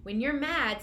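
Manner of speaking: normal talking voice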